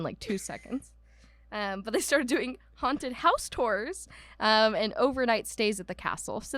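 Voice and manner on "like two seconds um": normal talking voice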